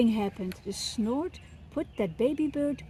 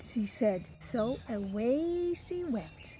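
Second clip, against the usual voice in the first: ordinary delivery, nothing marked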